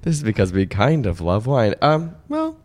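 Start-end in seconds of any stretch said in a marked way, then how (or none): none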